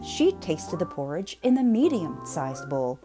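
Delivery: plain reading voice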